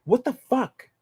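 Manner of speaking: high, incredulous what the fuck; imitating a baffled agent. Agent pulled off the car, high disbelief. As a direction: play the agent told to stop following, suddenly high and baffled. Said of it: "turn around and go".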